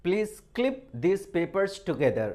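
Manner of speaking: each word read out very slowly; slow dictation. Shown as a demonstration. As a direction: spell it out slowly for students to copy. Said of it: "जैसे मैंने एक example बनाया है". (बहुत धीरे, शब्द-शब्द बोलकर)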